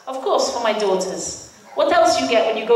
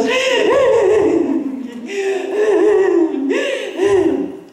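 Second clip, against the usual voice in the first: real laugh; 8 semitones higher than usual. Real, not acted. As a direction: laugh at herself. Laughs at her own crying.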